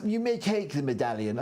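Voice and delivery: a hint of a nerdy character; nerd voice. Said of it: "Oh, do your nerd voice".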